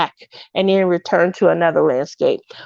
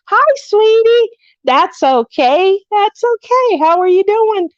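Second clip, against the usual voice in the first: sweet high greeting; 10 semitones higher than usual. Real, not acted. Reassuring a viewer in chat, high and cooing. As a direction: coo to her like a dear friend.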